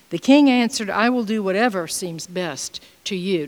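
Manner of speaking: formal Bible reading voice; scripture reading. Acted. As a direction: read the verse out to the congregation. Reads scripture aloud.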